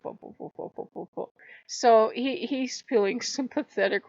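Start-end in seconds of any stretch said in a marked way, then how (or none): 0.0-1.3 whispered stuttering babble; very soft, whispered little sounds of comfort; hushed soothing. Retold or imitated. Mimics him soothing the wolf.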